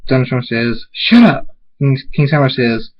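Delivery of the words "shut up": higher, louder outburst; irritated yell; angry retort. Acted. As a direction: cut the other off angrily. Prince snaps at villain.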